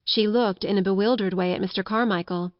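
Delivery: ordinary delivery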